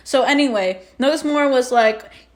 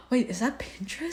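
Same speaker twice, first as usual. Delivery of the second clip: hushed, much softer puzzled question; suddenly distracted; 11 dB quieter than usual. Imitating friend noticing the logo mid-panic, hushed distraction. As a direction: imitate a friend's small, amused double take. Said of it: "then you were like".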